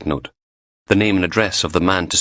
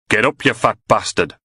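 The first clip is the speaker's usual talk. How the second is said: put-on louder, snarling Newcastle accent; 4 semitones higher, 3 dB louder than usual. A narrator's reading. Attacker shouting at Graham on the floor in a Newcastle accent. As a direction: bark it in Geordie. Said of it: "A Newcastle accent".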